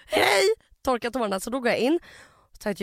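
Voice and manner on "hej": a high, loud, forced chirpy hello; fake cheerful hi. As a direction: cover the tears with a bright greeting. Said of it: "Och jag bara". (påklistrat glatt hej)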